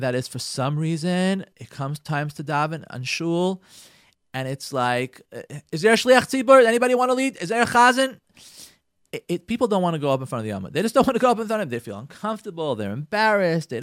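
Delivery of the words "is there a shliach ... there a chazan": calling out to the room. Retold or imitated. Mimics the shul calling for a leader.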